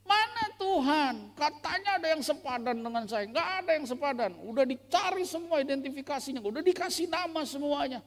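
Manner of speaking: high, loud indignant grumble; grumbling complaint. Acted. Adam grumbling to God, high loud complaint. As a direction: whine to God that nothing fits. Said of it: "Ngomel sama Tuhan".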